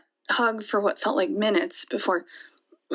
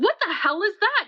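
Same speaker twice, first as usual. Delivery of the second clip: higher-pitched retold angry outburst; pissed-off yell; 10 semitones higher than usual. Anna demands answers after he pulls a knife, retold angry yell. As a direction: retell her indignant yell, not at full volume. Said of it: "Anna was pissed, and she yelled".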